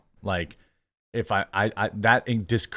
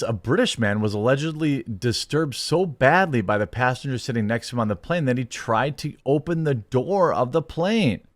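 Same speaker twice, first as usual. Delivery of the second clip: ordinary talk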